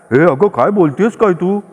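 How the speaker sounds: a mild irritated protest; irritated outburst. A narrator's reading. Husband Bhave mildly irritated at his nagging wife. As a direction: snap back, lightly annoyed. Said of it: "Bhave एकदम करवादले".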